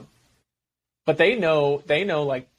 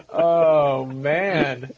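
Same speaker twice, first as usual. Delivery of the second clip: ordinary delivery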